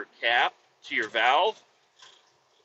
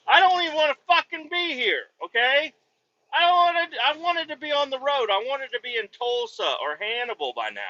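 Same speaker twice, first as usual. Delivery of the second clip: high, strained complaining voice; higher, wound-up frustrated rant; exasperated rant; 8 semitones higher than usual. Real, not acted. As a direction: vent at them, pitched way up. Venting frustration at the clients.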